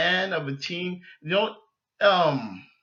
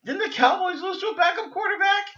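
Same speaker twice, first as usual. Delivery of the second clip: incredulous thought; 11 semitones higher than usual. Retold or imitated. Thinking back mockingly.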